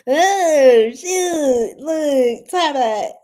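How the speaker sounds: high, loud laughing outburst; real laughter breaking into the words. Real, not acted. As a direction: crack up through the words. Cracks up while adjusting the camera.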